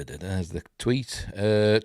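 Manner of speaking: usual speaking voice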